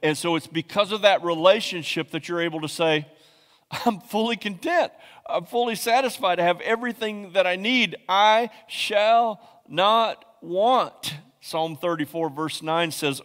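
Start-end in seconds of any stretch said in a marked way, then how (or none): 3.7-11.1 joyful declaration. Acted. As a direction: sing it out content. Voicing a contented believer.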